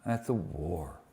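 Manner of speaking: quiet, measured reading of a poem. Acted. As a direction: read each line softly with pauses.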